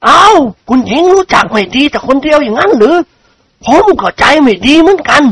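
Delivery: put-on high, teasing old gentleman; old nobleman. Chao Khun teases Khunying back, high and mocking. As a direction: answer her with mild mockery.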